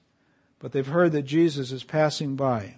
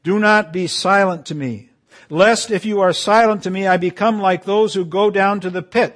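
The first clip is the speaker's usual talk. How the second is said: raised, pleading psalm reading; preaching psalm; 4 semitones higher, 6 dB louder than usual. Acted. Pastor reading David's psalm to the church, a raised, pleading read.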